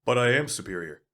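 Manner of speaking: smug gun insisting on being superior. Acted. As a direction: superior and proud of it. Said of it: "your superiority complex".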